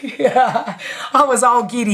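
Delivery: slow, giggly retelling; giddy. Retold or imitated. Woman recalling the good-looking store manager, giddy laughter. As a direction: laugh into the words, drawing them out. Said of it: "I was just, like, giddy".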